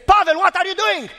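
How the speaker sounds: high, strained cry of alarm; alarmed friend, retold. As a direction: call out in panic to stop a friend. Friend's alarmed cry on rotten bridge.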